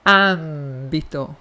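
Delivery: âmbito slow and low, open nasal a; Spanish âmbito. Shown as a demonstration. Teacher repeating Spanish âmbito slowly. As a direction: stretch out âmbito for the class. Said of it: "Tá vendo como ela é aberta".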